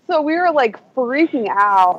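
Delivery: ordinary delivery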